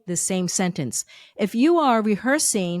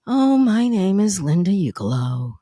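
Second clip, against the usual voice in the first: a little bored; 2 semitones lower, 4 dB louder than usual. Shown as a demonstration. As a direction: drag your name out with boredom. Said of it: "so a little bored".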